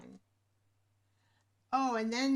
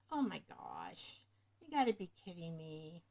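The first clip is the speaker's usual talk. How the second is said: exasperated; 6 semitones lower, 6 dB quieter than usual. Retold or imitated. Retelling her reaction to the late microphone, exasperated. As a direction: let the disbelief sink in. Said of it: "I'm like".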